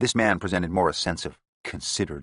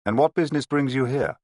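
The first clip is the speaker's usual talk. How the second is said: raised, higher demand; 7 semitones higher, 4 dB louder than usual. A narrator's reading. Detective demanding answers from the gang boss, raised voice. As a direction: press him hard for an answer. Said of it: "Jones demanded".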